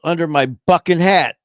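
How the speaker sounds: put-on high, loud kid punchline; cheeky little boy. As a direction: fire back like a sassy child. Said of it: "He goes".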